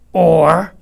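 loud warning; a loud threat cut short. A narrator's reading. Swamp threatening.

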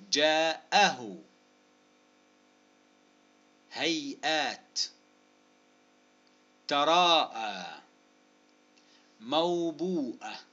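each word stretched with long vowels; very slow, spaced word list; slow dictation. Shown as a demonstration. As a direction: draw out each example word up to its hamza. Dictating example words slowly.

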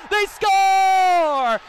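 drawn-out shouted goal call; long goal yell. Real, not acted. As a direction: stretch score out loud.

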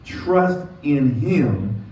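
slow, weighty "Trust in him"; preacher stretching "Trust in him"; slow emphatic reading. Shown as a demonstration. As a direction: drag each word out. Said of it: "the emphasis is in him".

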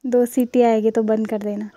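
loud instruction across the house; calling out. Real, not acted. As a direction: call it out so they hear. Calls cooking instructions to someone away. (ज़ोर से हिदायत देना)

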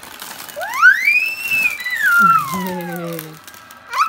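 long, very high, loud squeal. Real, not acted. Child screams over a new present, delighted squeal. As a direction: shriek with delight and hold it. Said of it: "Miren la gritadera".

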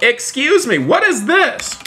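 high, startled squeal of disbelief; surprised. Real, not acted. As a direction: shoot up in shocked disbelief. Sudden odd thing in game.

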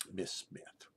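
solemn near-whisper with long pauses; hushed farewell. Real, not acted. Storyteller's farewell to a dead teacher, solemn hush.

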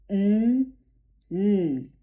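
n as a voiced, separately held sound; isolated syllable sounds. Shown as a demonstration. Teacher breaks down the first syllable, isolated voiced n. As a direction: sound each n alone, firmly held. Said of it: "trzy najważniejsze dźwięki w pierwszej".

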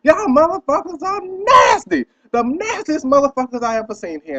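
loud high cussing out. Retold or imitated. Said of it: "All you hear me echo and cussing".

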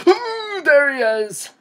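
high, loud scream of alarm; sudden piercing yelp into words; startled shriek. Real, not acted. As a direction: shriek as the monster jumps out. Jump scare as monster appears.

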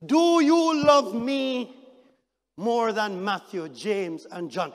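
slow, loud, stretched-out plea; slow searching question. Acted. Jesus asking Peter again, slow loud plea. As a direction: let each word hang.

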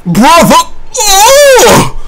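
high shocked yelp, brother oh; outraged cry. Real, not acted. Friend reacting to the roast with a high yelp. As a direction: cry out like the joke hurt.

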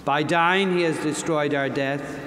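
ordinary talk, nothing marked

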